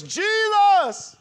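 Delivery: loud high cry of Jesus; desperate shout. Retold or imitated. Crying out while the car skids. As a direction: yell the name in panic. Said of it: "only thing I could say was".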